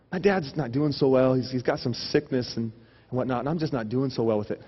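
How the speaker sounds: low, soft, halting confession; mock downcast. Friend confiding about sick dad, mock downcast. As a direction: pretend to confide a heavy burden.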